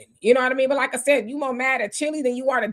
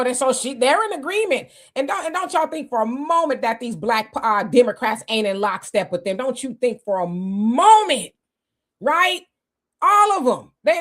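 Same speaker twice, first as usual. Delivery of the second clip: normal talking voice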